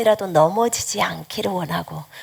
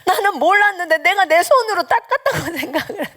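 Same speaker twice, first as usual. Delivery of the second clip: much higher, laughing through the words; 10 semitones higher than usual. Retold or imitated. Mother realizing the Lord wiped her tears, laughing. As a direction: giggle at your own mistake.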